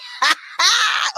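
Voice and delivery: high shrieking laugh. Real, not acted. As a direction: burst out laughing. Laughing at lyrics quote.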